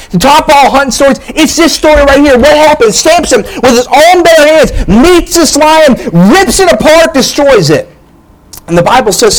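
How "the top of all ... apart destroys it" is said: rising preaching excitement. Real, not acted. Preacher hyping Samson's fight with the lion, building excitement. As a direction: preach it up as the climax of the story.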